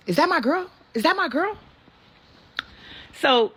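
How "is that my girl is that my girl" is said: high, excited double-check of who is calling; imitating her man's surprised high voice; excited disbelief. Man recognizing her voice on phone.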